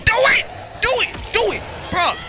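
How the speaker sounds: repeated high, loud yells; shouting. Real, not acted. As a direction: yell at the screen to do it. Urging game to let him.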